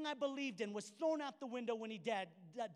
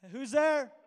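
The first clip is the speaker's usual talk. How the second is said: loud, higher shout from inside; startled shout; 4 semitones higher, 12 dB louder than usual. Retold or imitated. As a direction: yell back through the door, startled. Voice from behind locked door.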